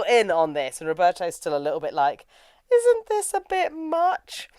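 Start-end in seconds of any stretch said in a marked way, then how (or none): 2.7-4.5 high, drawn-out doubtful question; hesitant doubt. Retold or imitated. Roberto doubting Joseph's get-up, high hesitant mock protest. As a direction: squeak it out slowly, unsure.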